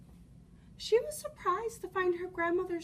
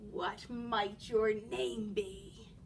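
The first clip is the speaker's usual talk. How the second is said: sly wolf; 6 semitones lower, much slower than usual. Acted. Wolf asks slowly.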